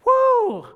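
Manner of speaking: a big, high, loud celebratory woo; joyful whoop. Retold or imitated. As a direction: shout for joy like the new believer did. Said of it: "that was his reaction".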